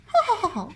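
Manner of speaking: short high polite cackle; a dainty wicked witch titter; polite witch laugh. Acted. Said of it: "This is my polite Wicked Witch laugh".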